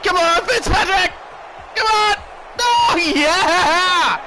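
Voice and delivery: loud high cheering shouts; excited cheering. Real, not acted. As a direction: yell for the runner, loud. Cheering interception return.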